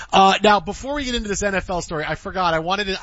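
normal talking voice

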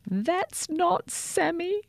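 put-on high, slow, childlike cry; a small girl close to tears; cried. A narrator's reading. Girl finding her toothbrush gone, crying out. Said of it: "cried Sophia".

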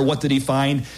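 normal talking voice

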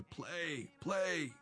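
high, urgent call to the band; exhorting. A narrator's reading. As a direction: urge them on through thick smoke. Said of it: "exhorting his band through the thick smoke".

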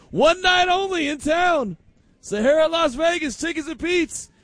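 high, shouted hype announcement; mock concert announcer. Host pretending to announce a reunion gig, high and shouting. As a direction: sell the one-night show like a promoter.

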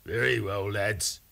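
softly voiced pirate captain giving consent; Salty Pete. A narrator's reading. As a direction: agree quietly, with captainly restraint. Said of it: "said Salty".

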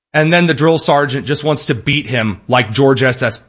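usual speaking voice

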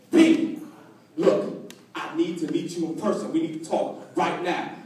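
low, hushed phone confidence; secretive friend. Acted. Friend calls comedian about a tapped phone, secretive. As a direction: drop low and soft, as if bugged.

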